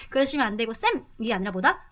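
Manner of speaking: ordinary delivery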